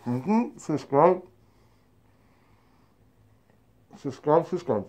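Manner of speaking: tobacco packed in mouth; slightly muffled mouth; talking with snus in mouth. Real, not acted. Seen 2: Dark material visible on teeth while talking; tins held near face.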